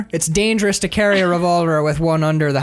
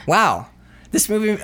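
ordinary delivery

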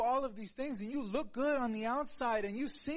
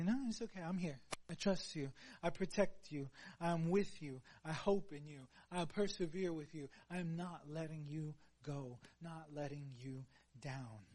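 softer and lower, fading at the end; tender comforting; 4 semitones lower, 5 dB quieter than usual. Acted. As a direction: reassure gently like holding someone. Voicing God comforting the listener.

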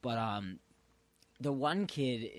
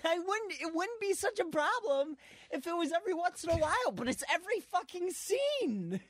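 high, fast, worked-up complaint; exasperated rant; faster than usual. Real, not acted. Telling a friend the show is too much, high exasperated rant. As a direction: rant like you can't believe it. Ranting about too many sex scenes.

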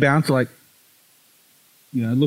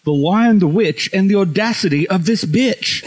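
mock indignation; 6 semitones higher, 3 dB louder than usual. Caps the mock outrage with joke.

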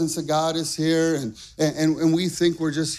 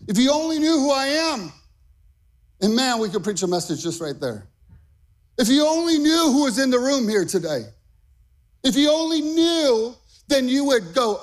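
full-force preaching; 8 semitones higher than usual. Acted. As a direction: push each "if you only knew" higher.

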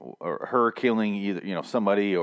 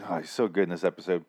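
ordinary talk

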